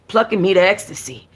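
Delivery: plain reading voice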